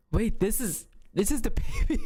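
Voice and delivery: can't believe it's over; stunned. Retold or imitated. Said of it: "I'm like".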